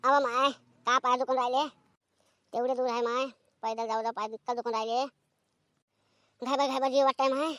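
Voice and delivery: low, soft, worn-out complaint about aching legs; tired moaning. Acted. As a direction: groan it out like legs ache from walking. (पाय दुखल्याने थकलेला कण्हता आवाज)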